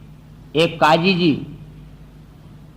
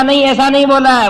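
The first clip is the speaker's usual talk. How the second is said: higher, louder blunt denial; wrestler's objection; 6 semitones higher, 4 dB louder than usual. Acted. Wrestler contradicting the others, loud denial. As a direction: push back hard.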